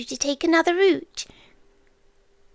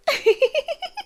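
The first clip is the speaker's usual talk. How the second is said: a light airy laugh up high; 12 semitones higher than usual. Acted. Creature amused by the searching human, light high laugh.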